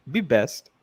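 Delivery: lightly imitated Russian accent. Host repeats the running joke, Russian accent. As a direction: toss it out in a mock Russian accent. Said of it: "in her Russian accent".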